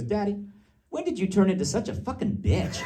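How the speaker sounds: high-pitched child's voice, imitated; little daughter. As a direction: imitate a small girl confronting Daddy. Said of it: "she turns to me and she goes".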